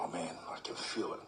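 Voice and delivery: exhausted whisper; whispered thought. Retold or imitated. Player lagging in fitness drill, exhausted whisper.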